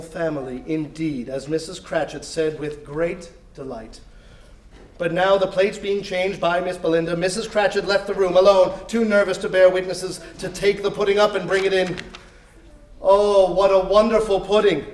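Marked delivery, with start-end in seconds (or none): none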